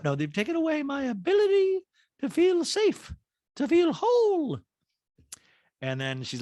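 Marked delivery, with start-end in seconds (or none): 0.1-4.6 very high put-on voice, slowing on "whole"; Dorit impression. Podcaster correcting her Dorit quote, high put-on voice. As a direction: redo the line cleanly in squeaky Dorit voice.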